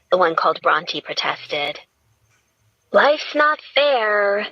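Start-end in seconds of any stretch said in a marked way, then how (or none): 2.9-4.5 slow, bright and cutting; cheerfully acerbic. A narrator's reading. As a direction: tease with a sharp smile. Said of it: "in a cheerfully acerbic voice".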